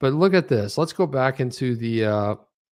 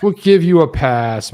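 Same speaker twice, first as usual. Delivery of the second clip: loud, slow, grand forgiveness; louder, slowed mock-generous pardon; booming pardon; 4 semitones higher, 10 dB louder, slower than usual. Real, not acted. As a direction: proclaim the pardon like a magnanimous judge. Ribbing a late guest.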